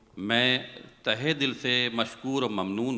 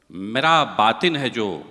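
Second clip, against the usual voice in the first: measured, lilting verse delivery; poem recitation; 6 dB louder than usual. Acted. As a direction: recite it like a mushaira couplet.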